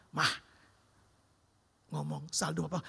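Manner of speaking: quiet confession. Retold or imitated. Telling wife about empty account.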